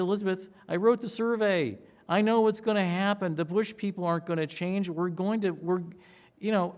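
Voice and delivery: high, loud, fired-up insistence; bursting with the news, convincing her; excited rush. Retold or imitated. Pollster at two in the morning excitedly tells his project director.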